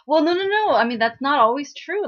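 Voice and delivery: high, loud, flustered denial easing off; hasty backtracking. Retold or imitated. Companion rushing to reassure the depressed girl, flustered and hasty. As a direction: jump in urgently to take it back, acted. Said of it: "he quickly says".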